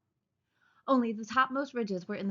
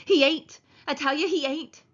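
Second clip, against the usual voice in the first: insisting; 7 semitones higher, 7 dB louder than usual. A narrator's reading. Lenny covering ears, protesting.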